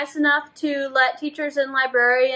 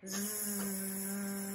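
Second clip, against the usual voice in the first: soft breathy snore; snoring sound; 6 semitones lower, 21 dB quieter than usual. Shown as a demonstration. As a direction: snore like a sleeping bear. Snore after refrain.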